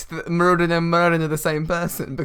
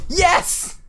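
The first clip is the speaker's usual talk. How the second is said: cheering; 12 semitones higher than usual. Real, not acted. Cheering the twist.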